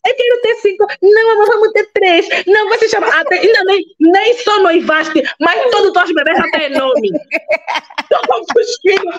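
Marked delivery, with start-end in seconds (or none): 0.1-4.6 much higher, playful back-and-forth; mock couple bickering. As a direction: squabble sweetly like new lovers. Acted couple bickering over kids.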